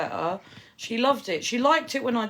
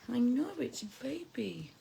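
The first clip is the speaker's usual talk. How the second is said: whisper; 4 semitones higher, 7 dB quieter than usual. Real, not acted. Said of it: "repeat whispered".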